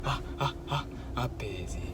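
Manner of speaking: lightly voiced calm master, soft and low; Master Shifu. Quoting Master Shifu, soft calm master voice. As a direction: whisper it like a serene kung fu master. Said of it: "Comme dirait Maître Shifu".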